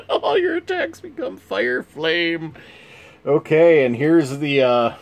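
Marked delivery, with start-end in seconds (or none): 0.1-2.5 high dramatic read. Acted. Dramatic card reading.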